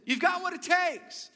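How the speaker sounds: high, projected holler; dad's encouraging yell, imitated; demonstrating shout. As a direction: call it out to raise an echo. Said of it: "Watch this".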